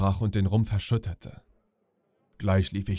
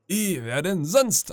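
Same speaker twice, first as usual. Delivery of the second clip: higher, slow and relieved; relieved old sailor; 8 semitones higher, slower than usual. Acted. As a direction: let the relief out slowly. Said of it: "sichtlich erleichtert". (erleichtert, langsam und höher)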